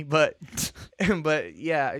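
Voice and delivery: normal talking voice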